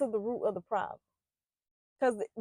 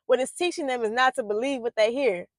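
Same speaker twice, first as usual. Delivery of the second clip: normal talking voice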